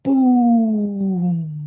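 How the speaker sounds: booming crash word; loud boom. A narrator's reading. Narrator booms the bed crashing through the floor. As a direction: belt out the crash.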